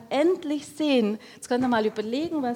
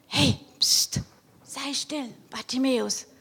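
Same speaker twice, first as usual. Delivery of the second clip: shushing crowd; 3 semitones higher than usual. Acted.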